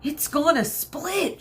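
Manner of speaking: panicked warning shout. A narrator's reading. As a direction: cry the warning out high and slow. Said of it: "shouted Mino".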